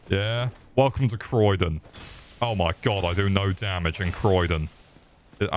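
ordinary delivery

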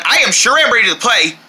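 ordinary delivery

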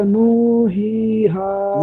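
"नू, ही, हा" with vowels dragged past two counts; over-stretched madd. Shown as a demonstration. Student checking how to hold the word, over-stretched vowels. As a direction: overdo the length of each vowel in "नू, ही, हा". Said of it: "आप दो से ज्यादा खींच रहे हैं".

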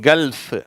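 கல்ஃப் said loud and high, L running into F; L and F sounded. Shown as a demonstration. Teacher modelling a word, கல்ஃப் with L and F joined. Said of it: "இல்லு, இஃப் ரெண்டும் சேர்ந்து வருது".

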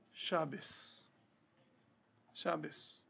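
hushed, heavy single word, low and soft; pained, quiet. Retold or imitated. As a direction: imitate a grieving old rabbi, barely voiced. Rabbi in pain utters one word.

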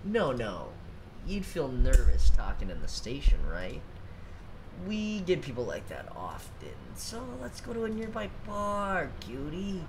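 lazy flirting drawl; lightly voiced sleazy cop; soft lazy flirty drawl. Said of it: "can you voice act that?".

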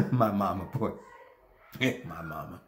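rueful low chuckle; laughing wistfully. Acted. Laughs heard, much lower.

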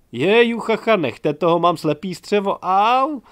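high, loud laughing squeal; laughing shriek. Acted. Girl at the table cracking up, loud squeals.

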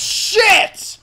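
sudden high yelp; loud shout. Real, not acted. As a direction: blurt it out alarmed. Door bombed, sudden alarm.

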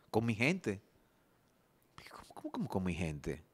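low, near-whispered disbelieving question; hushed, baffled repeat; incredulous echo. Acted. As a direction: question it under your breath, stammering on "cómo". Echoes the claim in disbelief.

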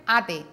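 put-on "ate" said as a child's clipped version of tomate; child's mispronunciation. Therapist imitating a child saying "ate" for tomate. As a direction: drop the "tom" and say just "ate". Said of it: "el niño dice ate".

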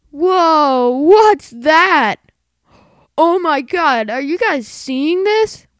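loud and high, amazed; excited shout. Real, not acted. Amazed at a new find.

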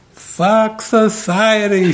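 slow, high-pitched mock outburst; hammed-up celebrity meltdown, words drawn out; ranting and raving. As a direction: wail it in imitated outrage. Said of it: "starts ranting and raving".

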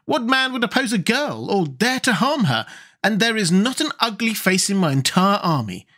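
put-on high, proud voice of a girl general; high-pitched young general, proud; General Ginger. A narrator's reading. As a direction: boast of your secret army. Ginger dismissing the danger.

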